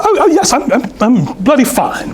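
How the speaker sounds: high-pitched, hammed-up British woman with a laugh; mock British accent; horrible English accent. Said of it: "My, my English accent is horrible".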